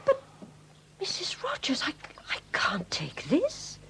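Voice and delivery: breathless whisper, slowing down; stunned whisper. Acted. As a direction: barely voice it, overcome by the gift. Overwhelmed by a precious gift.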